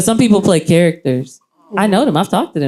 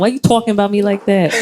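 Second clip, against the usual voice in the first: put-on higher-pitched New York accent; 4 semitones higher than usual. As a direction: play up a New York accent on a mock complaint. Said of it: "that was my New York accent".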